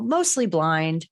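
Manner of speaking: ordinary talk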